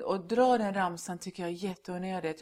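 normal talking voice

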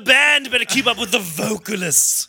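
slow, drawn-out diva mimicry; exaggerated prima donna put-on; mocking diva. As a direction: parrot it like a haughty diva. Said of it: "You sound like such a diva".

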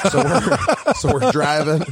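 giggling as he tries to go on; laughing. Real, not acted. Laughing while retelling story.

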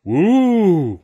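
high, loud cheering whoop; crowd whoop. A narrator's reading. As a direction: whoop like the audience. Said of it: "went the crowd".

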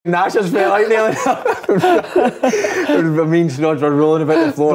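high, laughing through the words. Real, not acted. Recalling rolling about the floor, laughing hard. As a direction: struggle to talk through laughter. Cracking up at prank memory.

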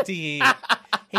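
slow, drawn-out imitated tee-hee; shy giggle. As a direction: stretch out a coy giggle, laughing through it. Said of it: "I promise you, he says".

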